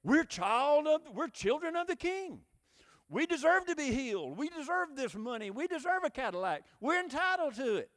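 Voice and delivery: high, loud mock boasting; hammed-up entitlement, pitched well above normal talk; entitled believers. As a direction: proclaim it like congregants demanding their due. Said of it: "this is what they're saying".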